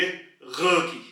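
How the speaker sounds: ग dragged out very slowly from the back of the throat; throaty drawn-out G. Shown as a demonstration. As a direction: hold a long rasped ग. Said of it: "throat की back से निकालेंगे". (गले से लंबा खिंचा हुआ ग)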